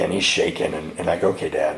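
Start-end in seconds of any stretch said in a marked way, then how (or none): 1.3-1.8 low, soft reply to an ailing father; acted subdued assent. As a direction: agree gently, dropping the voice. Answering his dying, shaking father.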